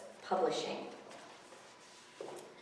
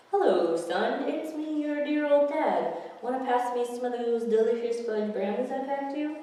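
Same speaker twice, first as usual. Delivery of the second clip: higher, louder put-on dad voice; a gull hamming up a dear old dad; fake dad voice; 6 semitones higher, 5 dB louder than usual. Gary posing as the kid's dad, hammed-up and loud.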